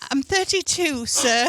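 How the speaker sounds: put-on high, earnest English child voice; English orphan accent. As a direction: correct him primly, orphan style. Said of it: "the English orphan".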